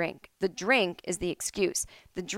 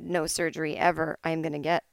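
usual speaking voice

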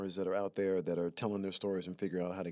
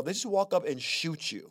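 ordinary delivery

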